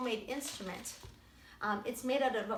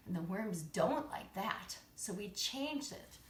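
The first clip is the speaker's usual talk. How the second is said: ordinary talk, nothing marked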